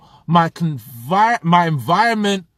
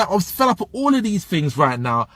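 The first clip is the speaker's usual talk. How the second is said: usual speaking voice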